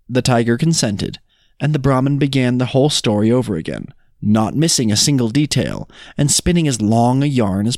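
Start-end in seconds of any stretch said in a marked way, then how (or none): none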